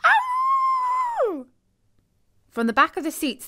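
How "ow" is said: high, loud yelp of pain; shrill ow of pain; pained howl. Acted. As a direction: cry out sharply. Character cries out in story.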